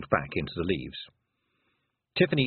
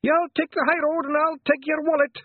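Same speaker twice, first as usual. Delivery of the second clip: very high, loud battle yell; war cry; 6 dB louder than usual. Acted. Pixie bellowing his war cry over the din, high. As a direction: roar it as you charge. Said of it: "each one had his own cry".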